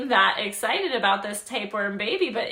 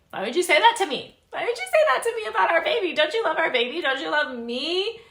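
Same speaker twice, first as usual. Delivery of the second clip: much higher, fast, louder frantic pleading; hysterical pleading; 8 semitones higher, 4 dB louder, faster than usual. Retold or imitated. Agnes begging Zoe not to question the baby, frantic. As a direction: spiral into shrill panic.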